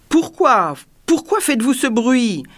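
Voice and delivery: high, accusing outburst that falls at the end; furious. A narrator's reading. King turning on the dwarf over the noise, furious. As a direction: fire the question at him in fury. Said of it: "se retournant avec fureur vers le nain".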